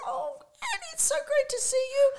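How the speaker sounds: very high, gushing squeal; shrieky mock delight; excited reunion. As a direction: imitate a friend thrilled to bump into you.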